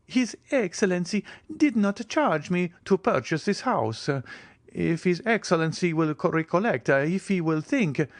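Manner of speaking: the steward caught out, lightly voiced; strangely cried. A narrator's reading. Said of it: "cried the steward with a strange expression".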